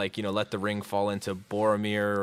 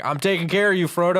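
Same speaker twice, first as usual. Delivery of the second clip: raised, louder heartfelt Sam; earnest Sam voice; 8 semitones higher, 5 dB louder than usual. Retold or imitated. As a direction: voice Sam's devoted promise. Sam voiced, big pitch jump.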